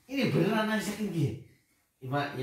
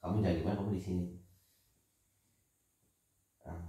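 ordinary talk, nothing marked

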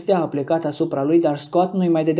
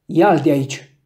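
command; 4 dB louder than usual. A narrator's reading. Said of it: "porunci Scott".

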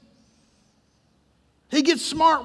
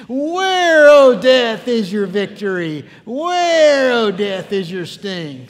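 sarcastic victory taunt; 7 dB louder, much slower than usual. Shown as a demonstration. Preacher leading the congregation in taunting death, sarcastic. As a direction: taunt defiantly, swelling on each opening word. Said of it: "sarcasm in there".

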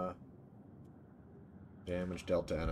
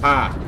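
sharp frightened scream, much higher and louder; startled scream; 9 semitones higher, 19 dB louder than usual. Real, not acted. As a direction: yelp in real fright at a sudden jump scare. Jump scare in game.

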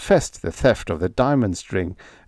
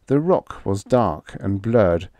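ordinary delivery, nothing marked

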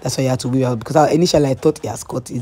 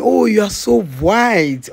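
higher, slow, admiring mom voice; imitating the mom; 7 semitones higher, 4 dB louder, slower than usual. The mom praising the guest's skin, lightly voiced admiring. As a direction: gush like an admiring mother. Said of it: "She was telling me".

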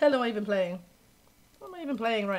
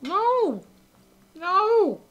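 much higher, strained cry of no; anguished no; 9 semitones higher, 7 dB louder than usual. Real, not acted. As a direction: wail at the board. Reacting to bad play.

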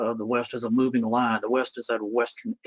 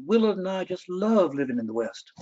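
put-on North Carolina accent; 6 semitones higher than usual. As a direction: gush about home in a North Carolina lilt. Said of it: "she had this wonderful North Carolina accent".

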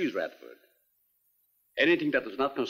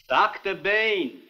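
loud, high, drawn-out shout of a name; 7 semitones higher, 5 dB louder, much slower than usual. Acted. Doctor summons his absorbed assistant, shouting. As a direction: shout slowly to get through to him. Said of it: "There's no need to shout".

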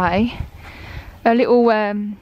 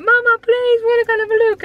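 put-on kids begging; 13 semitones higher, 5 dB louder than usual. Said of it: "the boys were like".

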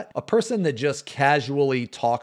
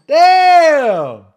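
huge, much higher and louder "Damn!" held out long; stunned shout; 14 dB louder, much slower than usual. Real, not acted. Streamer reacts to a brutal comment with a long stunned shout. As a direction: let it burst out in disbelief.

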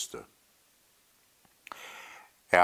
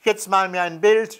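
put-on high, louder child's call; boy calling out; 10 semitones higher, 5 dB louder than usual. A narrator's reading. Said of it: "rief der Junge". (hohe, laute Kinderstimme, rufend)

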